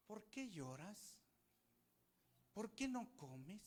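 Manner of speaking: slow, drawn-out puzzled questions; mock clueless husband. Husband slowly questioning his weeping wife. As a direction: stretch each question out slowly. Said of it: "miren lo que hace Elcana".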